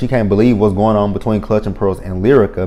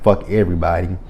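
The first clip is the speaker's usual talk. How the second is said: ordinary talk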